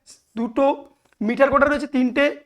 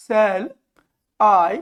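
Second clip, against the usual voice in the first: very slow reading with long pauses between words; measured, widely spaced words; 3 semitones lower, much slower than usual. Shown as a demonstration. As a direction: dictate the verse one piece at a time. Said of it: "এই মিটারটা আমি কিভাবে করব".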